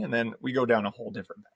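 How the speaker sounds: ordinary delivery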